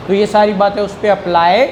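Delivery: ordinary talk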